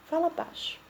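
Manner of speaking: whispered softly; soft whisper demo for the class. Shown as a demonstration. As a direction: whisper it to show how.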